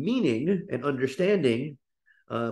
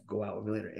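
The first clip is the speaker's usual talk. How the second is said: softer, words flowing together; soft connected speech; 5 semitones lower, 12 dB quieter, much faster than usual. Shown as a demonstration. Teacher demos the flowing version, softer. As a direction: let "go out with me later" run together quietly.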